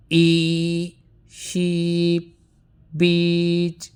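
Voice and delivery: long ee in sheep and beach, modelled clearly; long E demo. Shown as a demonstration. Teacher models long ee sound for students, clearly drawn out. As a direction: hold the ee vowel in sheep and beach for the class. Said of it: "the word is".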